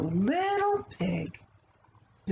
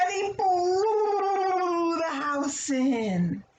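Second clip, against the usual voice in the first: dramatic slow climax; 9 semitones higher, much slower than usual. A narrator's reading. Climax of the wolf's attack.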